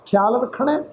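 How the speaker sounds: normal talking voice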